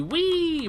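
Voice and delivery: high tipsy squeal of glee. Real, not acted. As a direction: let out a giddy squeal. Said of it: "I'm a little tipsy".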